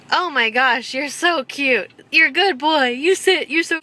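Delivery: loud, high delighted praise; gushing at the dog. Real, not acted.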